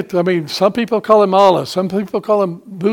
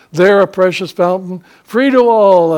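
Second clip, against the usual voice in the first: rising hymn proclamation; 2 semitones higher, 7 dB louder than usual. Real, not acted. Preacher swells into a hymn line for the congregation, loud and rising. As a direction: lift the hymn words up. Quoting hymn "near the cross.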